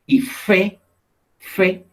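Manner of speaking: fe said alone, high; syllable stressed. Shown as a demonstration. Teacher isolating the second syllable of café. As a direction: set fe apart as the second beat. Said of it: "otro grupito de sonidos".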